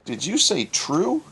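puzzled high "True?" rising in disbelief; baffled caller, pitched high; confused customer on the drive-thru speaker. Acted. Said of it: "came a confused voice".

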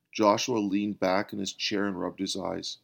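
plain reading voice

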